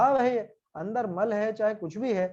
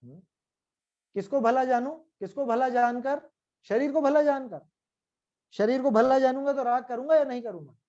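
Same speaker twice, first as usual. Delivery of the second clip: ordinary delivery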